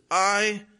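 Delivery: "I" said high and loud; stress on I. Acted. Joseph reassuring his brothers, stressing "I". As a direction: lean hard on "I". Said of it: "is accented".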